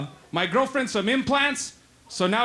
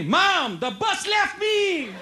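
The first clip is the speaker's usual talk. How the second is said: high, drawn-out cry to mom; 8 semitones higher, much slower than usual. Acted. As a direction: wail it like an aggrieved kid.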